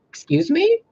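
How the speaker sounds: "Excuse me?" flung up high and loud; a high, indignant yelp of disbelief; seeing her own body, stunned and loud; shocked disbelief. Retold or imitated. Seeing herself dead in bed.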